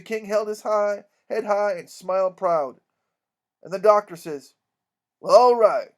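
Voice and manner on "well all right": loud, emphatic washing of hands; exasperated resignation. Acted. Doctor abandoning the deceived girls, exasperated. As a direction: give up on them with a firm final word.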